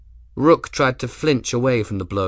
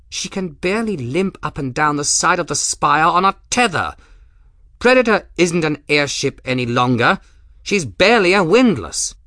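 higher, louder, haughty commodore; Rook's voice; 7 semitones higher, 6 dB louder than usual. Acted. Rook lecturing Grim on his ruined ship, higher and louder. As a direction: look down on the captain from a height.

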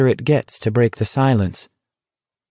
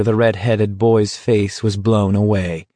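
usual speaking voice

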